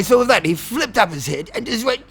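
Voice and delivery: usual speaking voice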